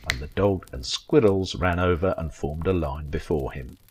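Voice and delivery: usual speaking voice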